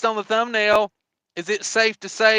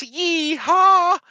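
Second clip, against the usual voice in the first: whooping yeehaw; 10 semitones higher, 5 dB louder than usual. Real, not acted. Greeting a viewer.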